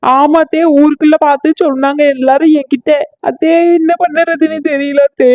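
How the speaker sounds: weepy. Acted. Daughter-in-law tells bad news.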